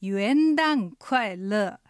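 元旦快乐 drawn out syllable by syllable; ゆっくり発音の手本. Shown as a demonstration. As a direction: say 元旦快乐 very slowly, every sound clear. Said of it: "発音はひらがなであえて書くなら". (元旦快乐を一音ずつゆっくり発音)

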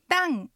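旦 with a falling tone; fourth tone. Shown as a demonstration. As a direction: start 旦 high and drop it sharply. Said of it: "が下がる四声". (旦を下がる四声で)

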